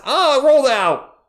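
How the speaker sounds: high, loud groan of disappointment; surprised shout. Real, not acted. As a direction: cry out as the ball rims out. Live play-by-play miss.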